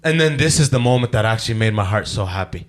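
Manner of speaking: ordinary talk, nothing marked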